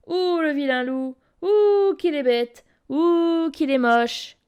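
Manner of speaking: mocking. A narrator's reading. Girl mocks wolf.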